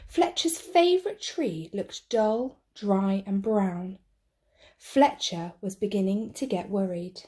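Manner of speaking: plain reading voice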